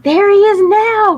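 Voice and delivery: high, loud excited cry. Acted. Baker spots cookie on the hill, loud cry. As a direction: call out, pointing at him. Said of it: "cried the baker".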